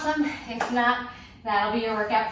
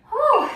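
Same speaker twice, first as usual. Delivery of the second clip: big relieved whew after exertion; loud exhale; 13 semitones higher, 11 dB louder than usual. Real, not acted. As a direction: blow out a loud winded breath. Winded after a hard exercise set.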